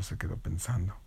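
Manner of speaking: fading to a breathy whisper; whispered thinking. Acted. Storyteller trailing into suspense, in a whisper. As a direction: let it dissolve into a whisper.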